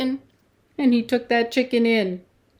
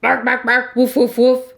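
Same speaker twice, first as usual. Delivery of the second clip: louder woofing dog voice; dog barking; 4 semitones higher, 6 dB louder than usual. Acted. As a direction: bark and woof like a dog. Dog barks in story.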